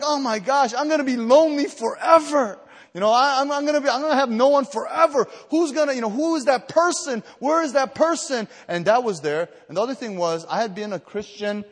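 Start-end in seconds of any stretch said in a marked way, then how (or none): 0.0-8.5 much higher and louder, wailing mock anguish; hammed-up teen despair. Preacher replays his lonely teen self, loud mock wail. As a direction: overplay a lonely teenager's cry. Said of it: "And I'm like".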